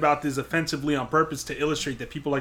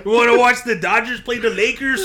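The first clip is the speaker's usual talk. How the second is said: put-on heavy Mexican LA accent; 8 semitones higher, 8 dB louder than usual. Retelling a sitcom scene to a friend, loud, high Mexican LA accent. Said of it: "with a heavy Mexican L A accent".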